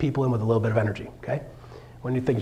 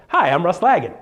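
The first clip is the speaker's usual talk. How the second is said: louder, more energetic intro; more bump; 3 semitones higher, 5 dB louder than usual. Shown as a demonstration. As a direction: push the greeting up a notch. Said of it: "Little more bump".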